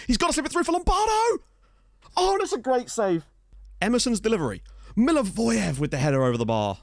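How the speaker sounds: high, urgent football commentary, peaking on the chance; excited play-by-play. Real, not acted. Gamer calls chances for Skov Olsen and Lombardo, excited commentary. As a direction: chase the attack with rising excitement.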